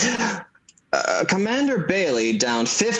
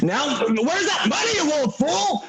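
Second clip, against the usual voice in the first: angry shout; 11 semitones higher than usual. Acted. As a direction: blow up at the old man, rising to a yell. George furious at Uncle Billy.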